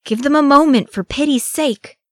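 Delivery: high, loud outcry; pleading cry. A narrator's reading. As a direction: cry out in protest, pitched well up. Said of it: "he cried".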